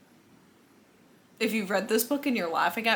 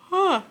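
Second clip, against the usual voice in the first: yawn; 11 semitones higher, 7 dB louder than usual. Real, not acted. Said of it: "I'm yawning a lot, clearly".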